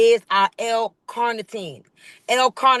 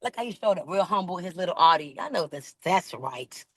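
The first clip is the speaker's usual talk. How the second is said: teasing aside; 4 semitones lower, 5 dB quieter than usual. Real, not acted. Teasing aside about the owner.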